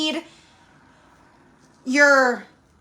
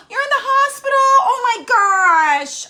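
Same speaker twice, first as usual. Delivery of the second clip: shocked gasp; 7 semitones higher, 4 dB louder than usual. Real, not acted. Viewer in hospital, shocked.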